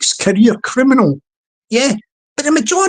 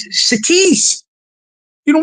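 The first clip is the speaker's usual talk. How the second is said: usual speaking voice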